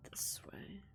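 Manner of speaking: unvoiced whisper, barely there. Real, not acted. Gamer whispering directions to herself, in a whisper. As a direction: breathe the hint out under your breath.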